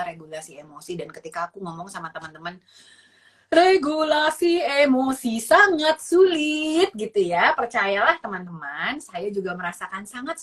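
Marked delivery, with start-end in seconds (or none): 3.5-6.9 slow, high, drawn-out complaint; hammed-up declaration of hardship; exaggerated emphasis. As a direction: stretch every word loudly and high. Playfully exaggerates how hard it is.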